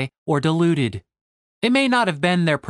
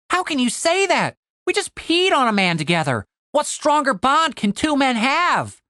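high, hammy indignation; Tim, wounded protest; 10 semitones higher than usual. Acted. Tim is stung by Cooper's rebuff, protesting. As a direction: plead in a much higher pitch.

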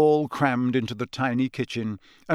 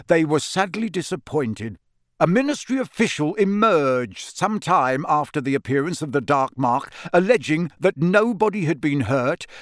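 raised, louder reporter's read; newspaper read; 3 semitones higher, 3 dB louder than usual. A narrator's reading. Father reading the article to the family in a newsy voice.

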